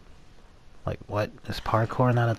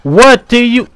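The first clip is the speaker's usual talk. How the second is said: screaming high and loud in panic; panicked scream; 7 semitones higher, 18 dB louder, much slower than usual. Real, not acted. Player caught by the monster, screaming in panic. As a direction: shriek as the monster grabs you.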